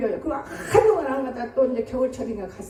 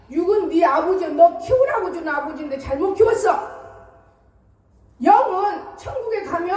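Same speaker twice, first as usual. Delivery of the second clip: raised, higher voice, pressing the point home; urgent preaching to son; 5 semitones higher, 4 dB louder than usual. Retold or imitated. Mother urging her son to read the Bible, raised and insistent. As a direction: plead with him loudly to know God.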